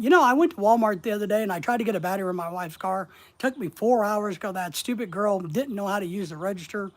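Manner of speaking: quick, running storytelling pace; sped-up talk. Shown as a demonstration. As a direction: rattle off the anecdote without stopping. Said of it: "we can also speed up".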